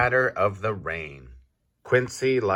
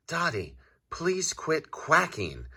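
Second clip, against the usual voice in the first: pleading quiet quail; 9 semitones higher, 5 dB quieter than usual. A narrator's reading. Quail asks duck to stop.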